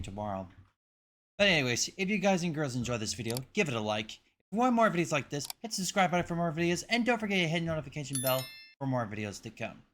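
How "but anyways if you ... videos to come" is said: high, bright sign-off pitch to the viewers, fast in places; upbeat outro. Real, not acted. YouTuber signing off the reaction video, upbeat outro. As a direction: sell the like and subscribe with energy.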